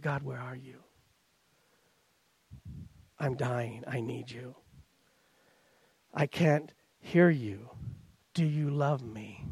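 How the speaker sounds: near-whisper, slow, aching plea to God; whispered prayer. Retold or imitated. Believer fallen before God, whispering a slow desperate plea. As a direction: breathe it out like a prayer on your knees.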